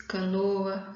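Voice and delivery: ordinary talk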